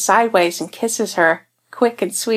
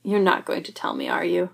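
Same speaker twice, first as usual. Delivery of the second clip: quieter, resigned tone; soft resigned question; 7 dB quieter than usual. Acted. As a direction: let it drop, quiet. Clint's soft far voice.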